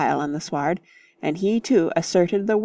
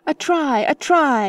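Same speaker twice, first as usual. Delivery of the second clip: high, louder playful yell; 8 semitones higher, 3 dB louder than usual. Acted. Freddy claims a rugby try in play, yelling. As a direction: yell it across the field. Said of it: "yelled Freddy".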